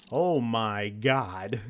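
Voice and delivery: drawn-out horrified Oh my God, slow and loud; stunned, stretching every word; horrified gasp. Real, not acted. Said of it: "reacting in horror".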